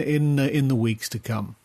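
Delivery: ordinary talk, nothing marked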